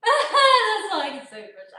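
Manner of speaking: loud, high, through laughter; laughing embarrassed. Real, not acted. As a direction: laugh it out at yourself. Laughing at own French.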